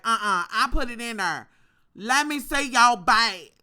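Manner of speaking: a loud mock of the rude cashier; sassy cashier. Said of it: "She was like".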